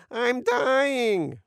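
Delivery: high, wailing mock woman; hammed-up feminine wail; feminine voice shout. A narrator's reading. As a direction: cry out in pain in falsetto. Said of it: "shouted in a feminine voice".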